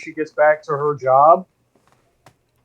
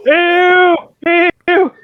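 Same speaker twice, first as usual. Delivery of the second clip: high, loud, drawn-out whoops; sustained high cries on each heave; crowd heave cry; 12 semitones higher, 5 dB louder, much slower than usual. Acted. Crowd heaving an unconscious drinker.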